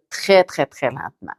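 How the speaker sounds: « très, très, très » détachés, le dernier plus doux; très lentement, détaché. Shown as a demonstration. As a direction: articule chaque syllabe lentement. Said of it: "mais en prononçant, là, chaque syllabe". (lecture lente syllabe par syllabe)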